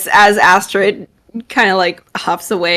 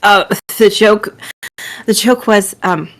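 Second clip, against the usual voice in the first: haltingly, trying to hold it together; flustered, stop-start delivery; barely composed. Acted. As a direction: start the joke, stall, restart. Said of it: "trying to hold herself together".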